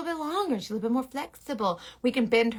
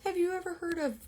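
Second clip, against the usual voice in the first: lightly voiced, higher girl talk; imitating other girls; 6 semitones higher, 4 dB quieter than usual. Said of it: "They're like".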